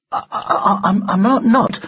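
put-on high, halting boy's voice; nervous stammer. Boy lost for words before the doll, stammering. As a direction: trip over each first word.

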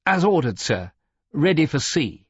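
eager junior officer reporting, pitched high; high, self-important naval report; boastful. A narrator's reading. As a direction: report it proudly to a superior. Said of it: "but it sounded like a boast".

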